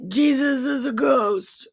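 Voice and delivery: high, whimpering fake panic; comic high-pitched distress; mock fearful wail. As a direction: whine it in hammed-up fright. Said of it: "they cried out in fear".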